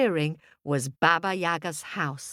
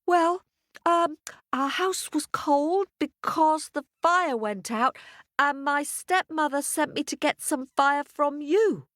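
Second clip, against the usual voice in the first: put-on nervous girl; 10 semitones higher than usual. A narrator's reading. Scared girl explains to witch.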